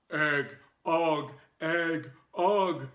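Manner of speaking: loud, blunt caveman chant. A narrator's reading. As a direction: grunt it like a caveman. Caveman voice speaking.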